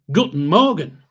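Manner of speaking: a jokey hammed-up German accent. Corporal greeting recruits each morning in mock German accent. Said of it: "with a German accent".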